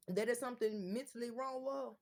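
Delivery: ordinary delivery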